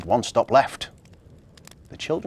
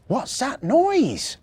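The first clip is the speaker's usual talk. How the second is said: high, slow, drawn-out elf question; wondering elf; 14 semitones higher, 5 dB louder, much slower than usual. A narrator's reading. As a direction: wonder aloud in a high little voice. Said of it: "wondered Marzy".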